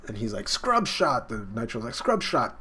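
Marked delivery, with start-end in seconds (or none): 0.5-1.3 higher-pitched mock battle cry; acted attack call. Fighter answers with his own attack, mock high call. 1.9-2.6 higher-pitched mock battle cry; acted attack call. Fighter answers with his own attack, mock high call.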